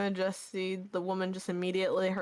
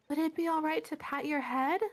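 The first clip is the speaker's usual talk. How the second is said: higher, polite asking voice; lightly voiced sweet woman; 8 semitones higher than usual. Woman asking the unicorn to pet her, lightly higher and polite. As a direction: ask gently, like a shy admirer.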